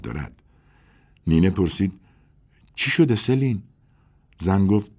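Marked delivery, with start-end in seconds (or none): 2.8-3.6 put-on higher, urgent young woman's voice; a woman fretting over the newspapers; worried young woman. A narrator's reading. Nine asks Celine. (زن جوان نگران، با صدای بالاتر)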